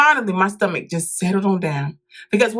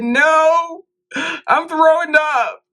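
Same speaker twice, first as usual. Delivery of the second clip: high, strained and dragged out; pained whimper; 8 semitones higher, 3 dB louder, much slower than usual. Retold or imitated. To the nurse at the door, a high, slow sick moan.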